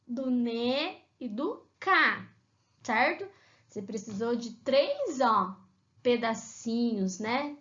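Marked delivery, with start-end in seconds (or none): 0.1-2.3 ne and ca said slowly, syllable by syllable. Shown as a demonstration. Teacher spelling out syllables for children, slowly. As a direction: break it into syllables: ne, ca. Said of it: "você precisou do bo".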